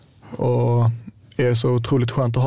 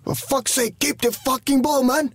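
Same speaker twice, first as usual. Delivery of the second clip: much higher, slower put-on English-accented scolding; Hojfeldt's odd English; 12 semitones higher, slower than usual. Player imitating Hojfeldt scolding him for losing the ball, high odd English accent. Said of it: "Om jag har slagit bort bollen kanske".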